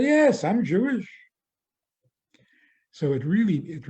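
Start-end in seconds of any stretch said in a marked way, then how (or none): 0.0-1.1 high, loud retort; mock surprised reply. Psychiatrist answering his patient with a mock surprised reply. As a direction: pop the admission out brightly.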